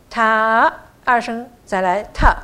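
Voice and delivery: 塔 drawn out rising, then 特 dropped in; turning long sound. Shown as a demonstration. Teacher shows the needed turn, 塔 long and rising before 特. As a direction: stretch 塔 upward, then land a falling 特. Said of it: "中间一定要有一个转音".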